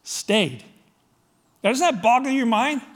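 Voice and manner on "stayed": usual speaking voice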